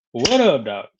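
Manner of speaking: ordinary talk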